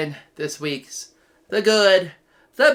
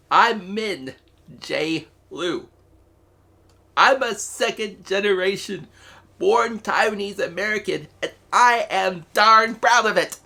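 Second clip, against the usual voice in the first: proud, rising voice, higher and fuller; choked-up proud declaration; getting emotional; 5 semitones higher than usual. Real, not acted. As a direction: swell with real pride and emotion. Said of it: "Sorry if I'm getting emotional here".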